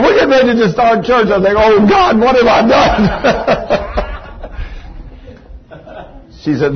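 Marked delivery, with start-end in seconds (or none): 1.5-3.9 slow, laughing oh God; slow laughing what have I done; laughing mock dread. Preacher recalls his panicked thought. 4.0-6.2 breathy silent laugh; soft wheezing laugh. Real, not acted. Preacher laughing at his story.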